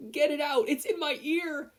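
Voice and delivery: high, loud panicked scream; panicked yell. Acted. Katsuki screams at Izuku as the worm enters, loud panic. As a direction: shriek it as the bug crawls in. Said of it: "Katsuki yelled".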